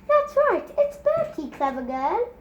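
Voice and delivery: put-on mother praising a toddler in baby talk; baby praise. A narrator's reading. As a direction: gush over the baby.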